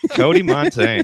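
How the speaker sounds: excited announcer. Pretend. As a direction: sing his name out like a host. Introducing Cody to the table.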